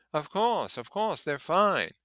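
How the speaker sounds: high, slowed, hollow reassurance; hollowly. Acted. Gatsby hollowly reassuring the narrator about the cakes, high and slow. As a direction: say it emptily, not believing it. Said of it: "he added hollowly".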